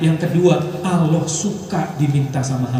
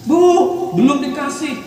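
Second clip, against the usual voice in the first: put-on whining crying child; 11 semitones higher, 3 dB louder, slower than usual.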